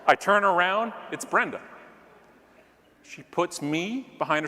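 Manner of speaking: usual speaking voice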